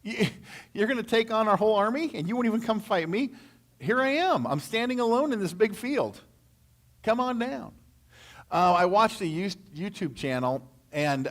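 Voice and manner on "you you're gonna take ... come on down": mocking Goliath. Preacher acting out Goliath taunting Israel's army, high mocking jeer. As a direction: jeer at them like a swaggering giant. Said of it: "He says".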